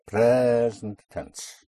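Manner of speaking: "present" with its first syllable pushed and held; present tense push. Shown as a demonstration. Teacher explains the tense signal, stretched stress. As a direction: lean long on PRE in "present tense". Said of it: "a present tense push".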